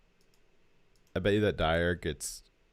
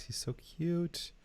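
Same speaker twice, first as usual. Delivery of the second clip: cooing; 9 semitones higher, 8 dB quieter, much slower than usual. Real, not acted. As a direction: melt over the little fox, drawn out. Cooing at little fox picture.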